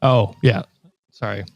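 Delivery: ordinary delivery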